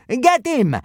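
loud, high battle yell. A narrator's reading. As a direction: shout the order to attack. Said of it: "yelled the big centurion".

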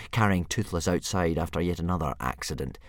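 plain reading voice

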